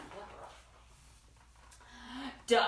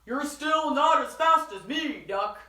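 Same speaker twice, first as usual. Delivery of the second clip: horse's thoughts; 4 semitones higher, 7 dB louder than usual. Acted. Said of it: "what he thought was".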